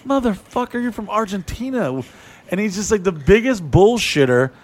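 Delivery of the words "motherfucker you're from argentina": high, incredulous deadpan jab; calling out his friend's lie in a mock aside; exasperated callout. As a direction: call out the lie with disbelief. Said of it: "And I'm like".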